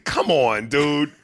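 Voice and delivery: ordinary talk, nothing marked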